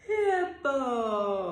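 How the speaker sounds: loud wide yawning cry; hippo yawn. Shown as a demonstration. Teacher yawning like a hippo for the kids. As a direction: open wide and yawn big. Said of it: "she yawns like this".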